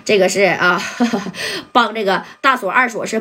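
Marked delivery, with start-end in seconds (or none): 0.9-1.7 short amused chuckle. Real, not acted. Narrator laughs at his aside.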